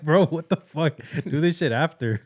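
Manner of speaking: incredulous shout at a stranger on the toilet; mock outrage. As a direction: scold the guy on the phone in disbelief. Outraged at phone caller.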